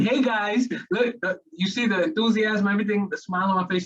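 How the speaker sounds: bright, high, smiling energy; enthusiastic. Shown as a demonstration. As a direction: pitch up with a big smile. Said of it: "let me try this again".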